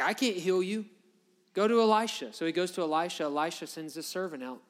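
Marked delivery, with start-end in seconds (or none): 0.0-2.3 freaked out. Retold or imitated. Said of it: "the king's freaked out".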